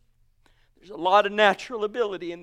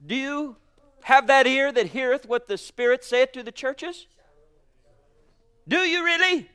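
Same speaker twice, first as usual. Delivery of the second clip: ordinary talk, nothing marked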